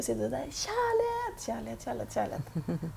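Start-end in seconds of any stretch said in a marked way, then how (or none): 0.5-1.4 very high, sung-out call. Acted. Medium shows client the word to shout, calling out. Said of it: "å rope". 1.5-2.9 falling, fading echo. Acted. Medium imitates love echoing back to client, fading echo. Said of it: "så er det ekko".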